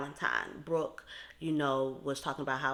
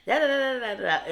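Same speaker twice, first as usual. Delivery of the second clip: mock rant; 8 semitones higher, 9 dB louder, faster than usual. Mocking Chicana's rant.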